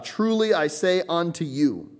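TRULY high and drawn out, making the point; emphatic. Shown as a demonstration. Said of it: "This is something emphatic".

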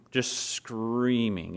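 "screaming" stretched out very slowly; drawn-out emphasis. Real, not acted. Father recalling his son's night terror, "screaming" drawn out. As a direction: draw out the word "screaming" for emphasis.

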